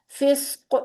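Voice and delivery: फिस्क with the final क़ bounced; फिस्क ending in an echoing qaf; qalqalah on final qaf. Shown as a demonstration. Teacher demonstrating qalqalah on फिस्क to students. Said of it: "फिस्कुन को हम पढ़ेंगे फिस्क".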